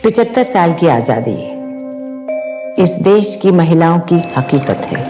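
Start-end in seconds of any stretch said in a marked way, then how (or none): none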